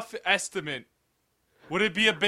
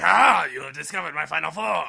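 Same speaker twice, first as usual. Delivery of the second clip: mock villain voice, opening on a big "Ah"; villain reveal; 4 semitones lower, 3 dB louder than usual. Friend voicing the transformed dragon joke, mock villain. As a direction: announce it like an unmasked cartoon villain.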